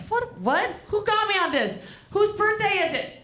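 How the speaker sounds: high, startled what-is-happening; surprised outcry. Real, not acted. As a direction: react as if ambushed by the crowd. Surprised at audience reaction.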